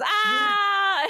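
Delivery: high shouted whale wail; screaming whale. Pretend. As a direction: let out a panicked whale cry. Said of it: "it just goes".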